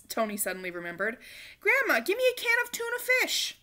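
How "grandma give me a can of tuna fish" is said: put-on high, eager child call; boy asking grandma for tuna; eager request. A narrator's reading. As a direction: ask urgently.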